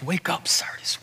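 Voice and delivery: soft breathy whisper through tears; a tearful Jesus whispering to the city; whispered plea. Retold or imitated. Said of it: "tears rolling down the eyes of Jesus".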